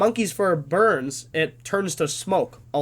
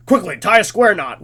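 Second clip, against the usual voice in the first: mock command; 3 semitones higher, 7 dB louder than usual. Mock order to scout.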